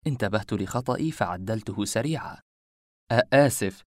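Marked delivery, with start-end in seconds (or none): 3.1-3.8 stammered, louder sorry; flustered apology. A narrator's reading. Man correcting his slip to the woman, flustered. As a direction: catch yourself and apologise hastily.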